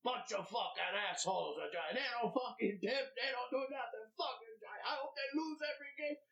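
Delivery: high, griping rant that speeds up at the end; bitter chef rant. Retold or imitated. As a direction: whine like a fed-up cook who never gets tipped. Voicing the angry sandwich chef.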